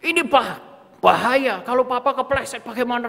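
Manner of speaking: high, loud scolding about the danger; angry father yelling again. Retold or imitated. Father scolding his kids after stepping on Lego, angry yell. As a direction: snap at the kids about slipping. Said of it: "langsung marah lagi".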